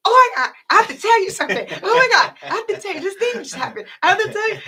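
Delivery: high, fast, breathless rush to tell a secret; excited gush. Retold or imitated. Telling her friend Locke a secret, in a high excited rush. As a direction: gush it out like you can't hold it in.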